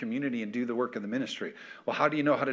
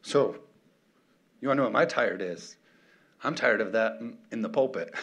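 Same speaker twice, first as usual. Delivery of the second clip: ordinary talk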